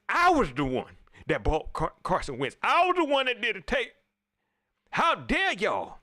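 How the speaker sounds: an indignant coach in a loud, high rant; Ron Rivera going off. Retold or imitated. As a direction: rant like the coach at a press conference. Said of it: "He was going off".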